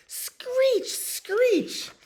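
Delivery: very high, loud screech; screeching owl. A narrator's reading. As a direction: screech like an owl waking everyone. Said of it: "An owl screeched".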